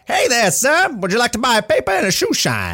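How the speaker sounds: high, put-on old-timey New York newsie voice; old-timey newsie accent, high and hammed-up. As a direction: hawk it like a Simpsons newsboy. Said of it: "talking like old-timey".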